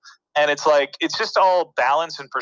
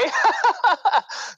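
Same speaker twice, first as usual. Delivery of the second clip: high giggle. Real, not acted. Laughing after retold okay.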